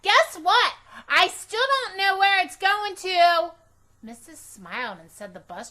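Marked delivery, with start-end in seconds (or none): 0.0-3.5 put-on very high, loud insistent girl; pestering for an answer; Junie B voice. A narrator's reading. Girl tapping teacher for the bus answer, loud and high. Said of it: "I tapped on Missus".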